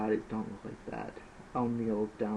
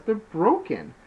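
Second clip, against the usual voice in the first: whiny; 11 semitones higher, 8 dB louder, slower than usual. Acted. Snake protesting, brother angry.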